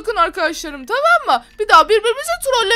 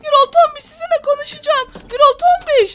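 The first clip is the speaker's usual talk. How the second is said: higher, louder, quick flustered cries; hammed-up panic; 6 semitones higher, 4 dB louder than usual. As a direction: panic as everything falls apart. Panic as fight breaks out.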